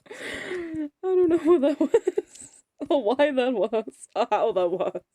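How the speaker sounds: loud, slow words squeezed through laughter; real laughing fit. Real, not acted. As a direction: talk while cracking up helplessly.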